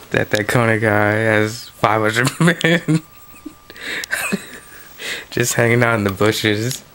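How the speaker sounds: amused, breaking into giggles mid-sentence; laughing. Real, not acted. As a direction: try to tell it while laughing. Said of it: "It always makes me laugh".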